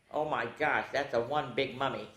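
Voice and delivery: usual speaking voice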